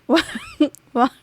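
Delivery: breaking into real laughter mid-sentence; laughing. Real, not acted. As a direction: try to explain through your own giggles. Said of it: "I'm laughing because I".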